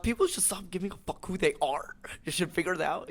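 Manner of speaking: high cracking rant voice; fired-up hustle guru; Gary Vee impression. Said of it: "he cusses a lot".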